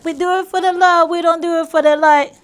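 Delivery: put-on loud Jamaican accent, lyric chant. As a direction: Jamaican patois lilt. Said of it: "We tried our best with my Jamaican accent".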